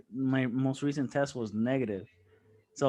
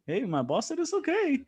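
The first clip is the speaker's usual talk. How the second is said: mock breezy reply; 11 semitones higher than usual. Cheeky reply to shocked coworkers.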